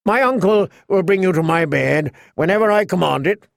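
put-on entitled, threatening young royal; Joffrey. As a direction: lay down a royal command like a spoilt youth. King Joffrey threatens Sansa.

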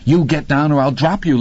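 acted exasperated order; angry threat. A narrator's reading. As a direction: warn them hard. Man threatens clinging woman.

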